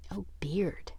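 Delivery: soft whispered aside. Shown as a demonstration. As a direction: breathe the words, puzzled. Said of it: "maybe that's a English phrase".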